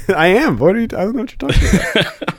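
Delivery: laughing while playing innocent; teasing his cohost through laughter; laughing denial. Real, not acted. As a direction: fake innocence breaking into laughter.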